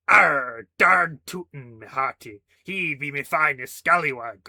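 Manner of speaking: loud, slow hammy pirate voice; pirate accent. Acted. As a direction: ham it up like a stage pirate. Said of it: "Gummy can be your pirate then".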